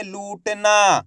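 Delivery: "लूटना" stretched, with a long final "naa"; drawn-out reading. Shown as a demonstration. Teacher explaining the aa vowel sign, word drawn out. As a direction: pull out the "oo" and "aa" of "लूटना". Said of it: "तो खींच के पढ़ेंगे".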